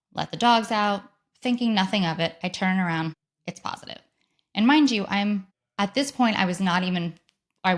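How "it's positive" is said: breathless whisper, stunned; barely voiced shock; hushed disbelief. Real, not acted. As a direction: let it slip out in a whisper. Discovering the positive test.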